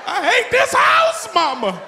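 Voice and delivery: put-on a kid wailing to his mother. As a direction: wail it out. Kid complaining again.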